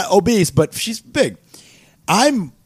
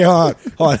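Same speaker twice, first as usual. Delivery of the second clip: usual speaking voice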